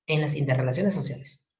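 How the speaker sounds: quiet echo of "En las interrelaciones sociales" for the class to note down. Shown as a demonstration. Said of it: "¿Dónde?".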